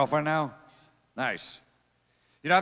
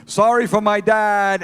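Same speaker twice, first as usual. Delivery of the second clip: imitated kid calling out, high and drawn-out; loud mock apology; 7 semitones higher, 7 dB louder, slower than usual. Son apologizing for his dad to a passerby, loud mock announcement. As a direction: announce it loudly and slowly to a stranger.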